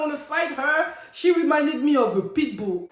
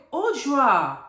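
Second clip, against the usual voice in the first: retold quote; 5 semitones higher than usual. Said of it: "and I'm like".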